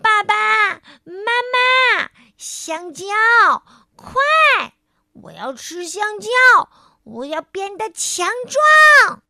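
starving little crocodile running home shouting; shouting for parents. Acted. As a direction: yell home hungry and urgent. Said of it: "一边喊".

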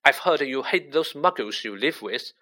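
usual speaking voice

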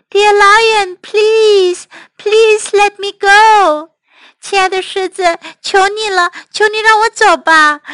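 high, loud, insistent pleading; begging mouse. Acted. Little mouse implores the lion to release him, begging. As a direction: keep begging, stretched and high. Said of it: "不停地在祈求".

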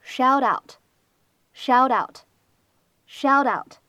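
"shout out" with shelled out run together, slow; "shelled out" linked into "shout out", repeated slowly; linking. Shown as a demonstration. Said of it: "我们呢可以读成是shout out".

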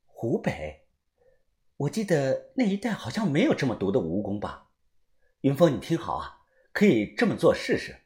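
muffled puzzled phone voice; puzzled, over the phone. A narrator's reading. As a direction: faintly wonder aloud. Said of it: "范神医疑惑的讲道".

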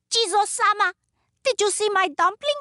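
high, loud, hurried question; high-pitched storybook little woman; funny little woman, urgent. Acted.

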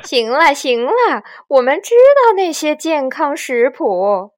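loud, dismissive villain; amused bandit chief; 强盗巴巴. A narrator's reading. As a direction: brush them off mockingly. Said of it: "强盗巴巴笑道".

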